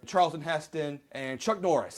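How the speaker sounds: normal talking voice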